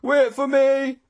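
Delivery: very high, loud cry. A narrator's reading. Straggler left behind on the hill, desperate cry. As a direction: wail it out urgently. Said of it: "a voice behind us panted".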